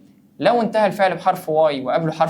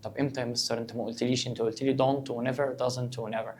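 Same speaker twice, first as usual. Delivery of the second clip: mock student complaint; 3 semitones lower, 8 dB quieter than usual. Teacher voices a student's complaint.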